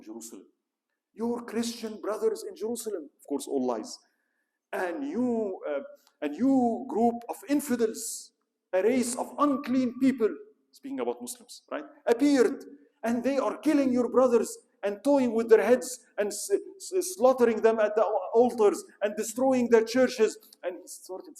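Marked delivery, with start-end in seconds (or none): 1.1-3.1 papal oration. Retold or imitated. Pope's speech to the kings. 4.7-10.3 higher, louder, slow denouncing the infidels; impassioned papal oration. Retold or imitated. Pope stirring the kings against the infidels, in a raised orator's voice. 12.1-20.4 higher and louder, building outrage; impassioned papal oration. Retold or imitated. Pope rousing the nobles of Europe, in a loud outraged voice. Pope's speech to the kings.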